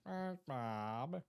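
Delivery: slow, drawn-out cartoon voice; half-hearted stab at a cartoon impression; SpongeBob. Shown as a demonstration. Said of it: "why I tried to do that".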